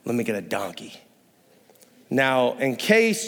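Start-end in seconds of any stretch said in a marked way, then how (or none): 0.1-0.9 low, soft, offhand Jesus; a low, easy Jesus picking a donkey; casual laid-back Jesus. Retold or imitated. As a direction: toss it off like it's no big deal. Jesus voiced casually choosing donkey.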